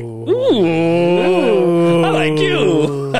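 a slow, playful come-on; mock flirty. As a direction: purr it back teasingly. Flirty reply to a compliment.

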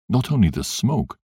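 ordinary delivery

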